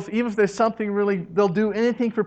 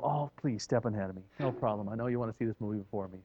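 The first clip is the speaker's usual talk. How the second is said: lower, softer, quickly self-effacing; soft yielding; 7 semitones lower, 10 dB quieter, faster than usual. Acted. Merciful person letting strangers ahead in the movie line, softly. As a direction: wave them ahead, almost apologetic.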